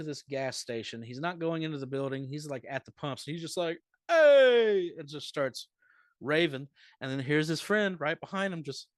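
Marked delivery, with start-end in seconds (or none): 4.1-4.9 sudden high loud shout of Hey; mock yell. Man at the gas pumps yells out, a loud mock shout. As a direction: bark a wild Hey across the pumps.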